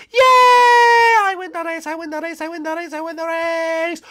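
very high, drawn-out squeaky cheer; squealing victorious bunny. Acted. Bunny celebrating winning the race, squeaky and gleeful.

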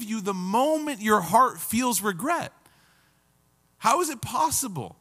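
high-pitched, incredulous reproach; acted astonished scolding, like the upset siblings; appalled disbelief. Shown as a demonstration. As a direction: ask it as if the offense were unthinkable. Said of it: "we have to read it like that".

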